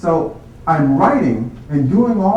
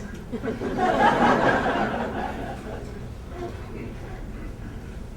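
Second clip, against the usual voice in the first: stifled laugh; 10 semitones higher, 8 dB quieter than usual. Retold or imitated. Secretaries laughing outside.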